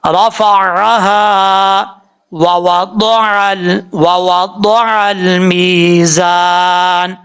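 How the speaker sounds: very slow chant with a clear عین; correct recitation. Shown as a demonstration. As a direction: recite slowly, ain from the throat. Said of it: "اس طریقے سے پڑھا جائے گا". (صاف عین کے ساتھ آہستہ تلاوت)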